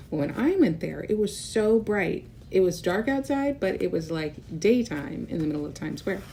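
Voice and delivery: plain reading voice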